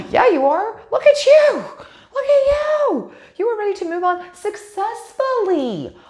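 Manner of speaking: high, loud, thrilled squeals with a gasp; squealing with real delight at a great tarot pull; super excited. Real, not acted. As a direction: gush with real excitement over the cards. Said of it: "I'm getting super excited".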